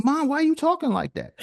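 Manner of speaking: put-on high, baffled kid asking; puzzled kid. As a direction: sound shocked at mom's voice. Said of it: "I was like".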